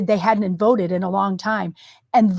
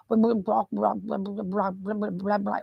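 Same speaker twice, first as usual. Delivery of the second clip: mumbled softer blah blah; marble mouth; 6 dB quieter than usual. Retold or imitated. Co-host mimicking Biden's marble-mouthed mumble, softer. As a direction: mush the words like marbles. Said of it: "like that".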